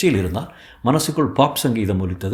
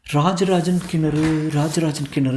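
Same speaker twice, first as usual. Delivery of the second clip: slow, high chant while searching; slow self-reminding repetition, pitched up; repeating to oneself; 5 semitones higher, slower than usual. Acted. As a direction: draw it out, reciting the title absently. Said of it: "என்று சொல்லிக்கொண்டே".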